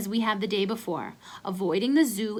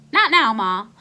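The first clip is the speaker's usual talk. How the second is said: much louder impatient reply; shouting back; 9 dB louder than usual. Acted. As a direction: shout it back toward the kitchen.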